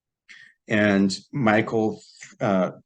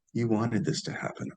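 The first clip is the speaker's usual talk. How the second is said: softer, level, matter-of-fact reply; a hint of a serene wise old man; calm; 8 dB quieter than usual. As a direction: answer calmly, like a patient elder. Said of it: "And very calmly".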